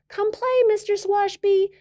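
put-on girl calling to the grumpy neighbor. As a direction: call up high like a little girl. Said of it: "the girl called back".

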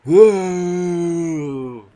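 drawn-out high creature wail; yeti scream imitation. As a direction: imitate a moron-like monster cry. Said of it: "That's what they sound like".

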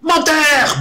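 very high loud bellow. A narrator's reading. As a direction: bellow the accusation. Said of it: "beugla l'orque le plus proche".

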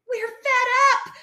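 high, loud exasperated wail; crying out. A narrator's reading. Defeated foxes to Fosdyke, crying out. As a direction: cry out fed up. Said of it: "they cried".

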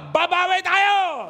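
slow, loud shouted slogan; drawn-out rallying cry. Real, not acted. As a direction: shout it out for the crowd to repeat. Preacher leads a call and response.

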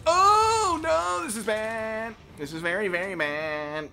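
high opening wail that settles down slowly; drawn-out, sinking oh-no; playful dismay. Real, not acted. Player in trouble with low energy.

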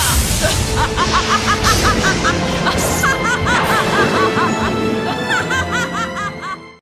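long, rising villainous cackle; evil laugh. Acted. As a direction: laugh on and on in wicked triumph. (高笑い)

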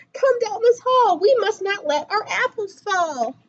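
ordinary delivery

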